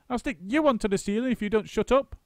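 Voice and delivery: a father losing patience; high, loud irritable monkey; snapped threat. A narrator's reading. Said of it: "snapped Mugglewump".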